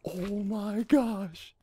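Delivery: very slow, hushed amazement; soft, drawn-out astonishment; stunned disbelief. Real, not acted. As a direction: let it out slowly and softly, in awe. Stunned after a lucky jump.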